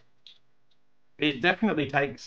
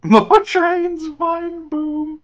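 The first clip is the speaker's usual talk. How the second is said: high, loud laughing squeak; squeaky mock give-in; 9 dB louder, much slower than usual. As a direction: squeal it out while giggling. Squeaky giggling concession.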